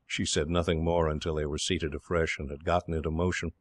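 usual speaking voice

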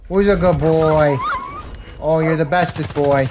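loud happy dog praise; excited dog praise. Real, not acted. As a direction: gush over the dog, loud.

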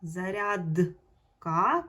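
"Зарядка" stretched very slowly with a rising end; teacher stretching "Зарядка" so the д is heard; drawn-out check word. Shown as a demonstration. Teacher to pupils, stretching the check word very slowly. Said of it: "перевірне слово".

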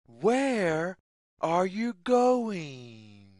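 ordinary delivery

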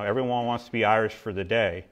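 ordinary talk

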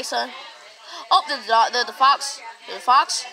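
ordinary delivery

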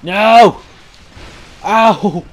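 loud, high cries of protest; pained yelling. Real, not acted. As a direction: yelp as you get hit. Gets hit in game.

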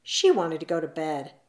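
ordinary delivery

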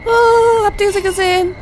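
high, loud frightened cries; panicked. Real, not acted. Scared gamer begging the monster to leave, high panicked cries.

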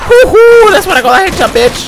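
very high loud whoop then gloat; loud gleeful victory cry; triumphant whoop. Real, not acted. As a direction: whoop over the headshot. Celebrating a headshot.

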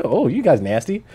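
ordinary delivery

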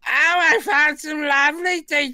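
very high, screechy Python-style drag falsetto; shrieking Pepper Pot voice; scroungy Cockney cleaning lady. Retold or imitated. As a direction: squawk like a man playing an old charlady. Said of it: "Monty Python in drag sketch".

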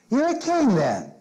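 pitched high, mocking disbelief; sarcastic. Pilate sneering at Jesus, high sarcastic jab. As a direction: scoff at the lowly prisoner's claim. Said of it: "You hear the sarcasm in his voice?".

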